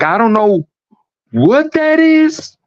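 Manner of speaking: high, slow, wailing mock cry; imitating crying woman. Retelling a crying passenger on a plane, high mock wail. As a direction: stretch it out like a panicked woman near tears. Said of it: "she's crying".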